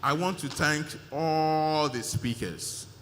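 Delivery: ALL stretched out long and loud; slow thanks, holding "all" on a long note; emphatic thanks. Real, not acted. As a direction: lean hard and long on ALL.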